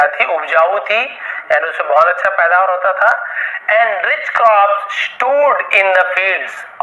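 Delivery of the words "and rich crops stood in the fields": very slow, deliberate read-aloud; slow story reading. Shown as a demonstration. Teacher reading the story line aloud to students, slowly. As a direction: read each word out slowly for the class.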